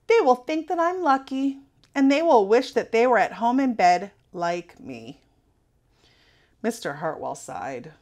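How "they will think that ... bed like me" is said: put-on defiant child. A narrator's reading. Sarah defiant.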